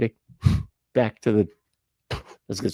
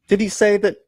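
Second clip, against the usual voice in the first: sharp, much higher and louder question; jolted by what he just heard; shocked disbelief; 10 semitones higher, 6 dB louder than usual. Real, not acted. Reacting to shocking claim.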